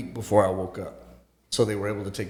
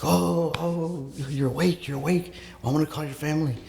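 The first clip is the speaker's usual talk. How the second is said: higher-pitched, mildly excited nurse imitation; retold excited nurse; 6 semitones higher than usual. Nurse finding the coma patient awake, mock excitement.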